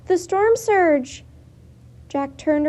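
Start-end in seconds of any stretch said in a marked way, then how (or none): 0.1-1.2 much higher, loud, last words slow; alarmed scream. A narrator's reading. Girl screaming to her brother at the coming storm surge, alarmed. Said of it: "Annie screamed".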